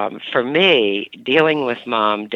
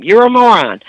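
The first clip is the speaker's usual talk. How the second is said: higher, louder mock jab; mother's insult repeated louder; 10 semitones higher, 6 dB louder than usual. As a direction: punch it harder the second time. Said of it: "It came the second time".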